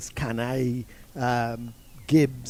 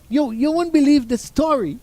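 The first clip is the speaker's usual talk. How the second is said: much higher, louder Jamaican voice; Jamaican coach confiding; 11 semitones higher, 9 dB louder than usual. Retold or imitated. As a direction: tease the tale in Jamaican. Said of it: "He said".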